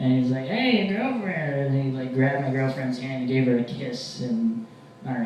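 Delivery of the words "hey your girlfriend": high, drawn-out delighted hey; friendly street guy. Acted. Vagrant greeting comic's girlfriend, high and slow. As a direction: greet her with a long, lifted slide.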